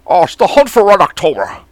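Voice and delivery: put-on Scottish accent, rolled and slurred; mock Scottish Sean Connery; Sean Connery impression. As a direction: lean into the Scottish burr. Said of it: "Oh, that's not bad, Sean O'Connery".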